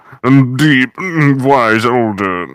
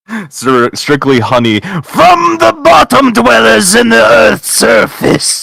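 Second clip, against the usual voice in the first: much higher, loud, slow villain shout; booming frost giant; 8 dB louder, slower than usual. Acted. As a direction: bellow it like a mad giant. Villain banter between actors.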